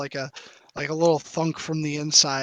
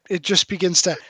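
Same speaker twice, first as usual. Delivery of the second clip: usual speaking voice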